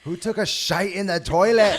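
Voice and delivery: loud, high, hammed-up British accent; mock outrage in a broad British accent. Friend showing they can do British, indignant British accent. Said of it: "You could do British".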